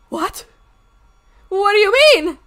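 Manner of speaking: high, loud incredulous outburst; shocked disbelief. Retold or imitated. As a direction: demand an explanation, loud and rising high in alarm. Retelling shock at drained account.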